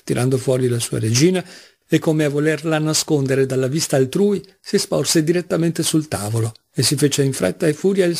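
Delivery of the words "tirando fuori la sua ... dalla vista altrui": ordinary delivery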